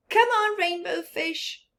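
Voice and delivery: high, loud playful call; calling fish. Acted. Other fish calling Rainbow Fish, high playful call. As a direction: call him over to play. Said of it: "they would call".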